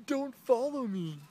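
high, loud mocking imitation; mock high-pitched yelp; mimicking her. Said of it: "And then she's like".

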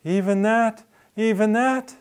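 incredulous, voice shooting up; shocked disbelief. Retold or imitated. Woman learning adultery includes mental sex, shocked.

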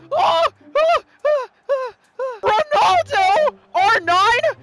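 high, loud yelps of panic; panicked shouts. Real, not acted. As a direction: yell as the attack unfolds. Big chance in the match.

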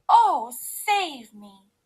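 loud, slow, drawn-out moan; theatrical moan. A narrator's reading. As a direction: moan as if begging for rescue. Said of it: "she moaned".

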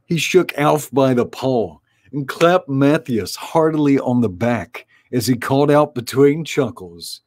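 plain reading voice

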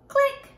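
bright mouth click; camera click. Pretend. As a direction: snap the shutter with a click. Pretend camera shutter noise.

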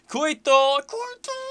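very high creature screech; squealing little dinosaur cry; baby velociraptor. Pretend. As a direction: screech the name like a baby raptor. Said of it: "Baby Velociraptor. That's what I am".

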